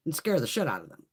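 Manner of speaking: ordinary talk, nothing marked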